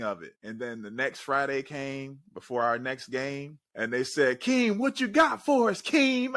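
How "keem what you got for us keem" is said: high, louder hyped call; teammates calling out. Retold or imitated. Teammates before the game calling on Keem to speak, high excited call. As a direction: call him up like an eager teammate.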